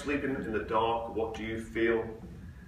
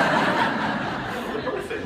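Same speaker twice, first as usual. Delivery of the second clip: repeating it while cracking up, very slow; laughing; 3 semitones higher, 11 dB louder, much slower than usual. Retold or imitated. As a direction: get the words out through laughter.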